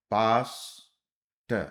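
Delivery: पास्टर drawn out slowly, syllable by syllable; slow spelled word. Shown as a demonstration. Shorthand teacher to students, saying पास्टर very slowly. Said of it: "पास्टर ऐसे हो गया".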